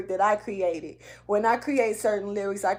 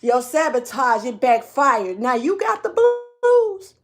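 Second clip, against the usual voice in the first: speaking strong; 3 semitones higher, 5 dB louder than usual. Real, not acted. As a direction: deliver it loud and high, stretching the key words. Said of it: "it's got me speaking strong right now".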